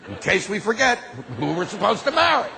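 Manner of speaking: usual speaking voice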